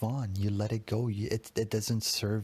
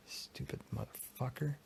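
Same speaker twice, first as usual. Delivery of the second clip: much softer, spat under the breath; harsh muttered insult; 2 semitones higher, 10 dB quieter than usual. Real, not acted. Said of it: "I'm talking harsh to myself".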